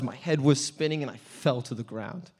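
ordinary talk

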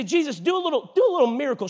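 high-pitched mock coaxing demand; goading. Pharisees needling Jesus for a sign, mock goading.

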